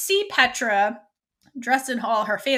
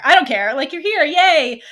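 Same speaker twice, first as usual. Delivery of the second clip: gleeful excitement; 4 semitones higher, 7 dB louder than usual. Retold or imitated. Raelle gleefully greets Scylla.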